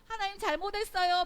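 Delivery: high, loud mock repentance; lip-service apology. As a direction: toss out a loud, high-voiced apology for show. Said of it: "말만". (높고 큰 소리로 말로만 비는 사과)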